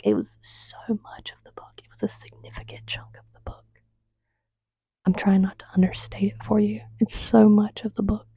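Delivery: hushed, confiding whisper. Real, not acted. Vlogger whispering to viewers how long the assault scenes run, hushed. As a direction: lean in and whisper it.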